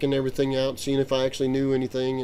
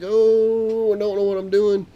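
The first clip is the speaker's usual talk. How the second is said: panicked; 8 semitones higher, 6 dB louder, slower than usual. Retold or imitated. Grabbing stick in panic.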